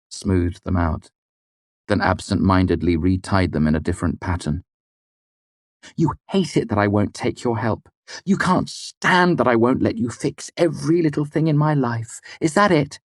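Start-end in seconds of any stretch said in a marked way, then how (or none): 5.9-13.0 high, rising bitterness; angry accusation. A narrator's reading. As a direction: needle him. Denna accuses Kvothe.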